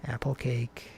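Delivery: ordinary delivery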